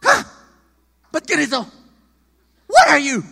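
mocking parent. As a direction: sneer and shout it down at the kid. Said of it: "the other thing of".

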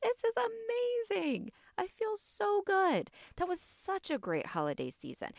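dreamy, delighted imagined future self; gushing delight. Pretend. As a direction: swoon over how good it all feels. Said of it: "It's gonna be like".

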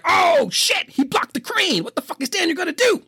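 excited shout. Retold or imitated. Kid at the movie theater shouting in high alarm. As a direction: cry out like a kid losing it at the movie. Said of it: "I was like".

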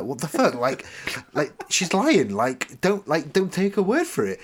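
loud, high-pitched mock outrage; retold frantic indignation; freaking out. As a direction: protest in hammed-up disbelief. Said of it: "And I start freaking out".

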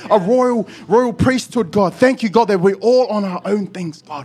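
fervent preaching prayer; loud prayer. Real, not acted. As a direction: declare it over the church. Praying aloud.

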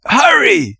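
loud, high, urgent cry; desperate, strained shout; hoarse cry. A narrator's reading. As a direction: cry out hoarsely to rush her. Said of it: "he cried hoarsely".

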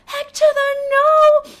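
high, loud, slow drawn-out refusal. Retold or imitated. Telling listeners her reaction to the thief, loud and high. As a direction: stretch out each word in a shrill no.

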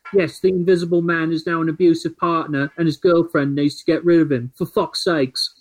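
put-on a fairly light British accent reading out an angry tweet. Podcast host reads a tweet aloud on request, British accent. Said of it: "you have to do it in a British accent".

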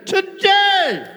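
high, shouted TODAY; emphatic cry. Acted. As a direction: cry out "today" as a soaring proclamation. Repeats Christ's word as climax.

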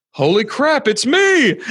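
high, rising mock astonishment; acted amazement. Guest replaying his reply on being recognized, amazed. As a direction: exclaim it as a hammed-up revelation.

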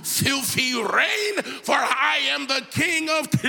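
booming theatrical King James command; dramatic movie Jesus. Pretend. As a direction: ham it up like an epic film Jesus. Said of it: "sometimes we think Jesus is real dramatic".

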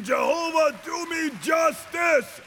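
shouting prayer. Acted. Kneeling believer begging Jehovah for justice, shouting. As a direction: plead for justice at the top of your voice. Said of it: "start saying".

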